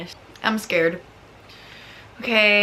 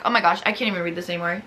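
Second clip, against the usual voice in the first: ordinary talk, nothing marked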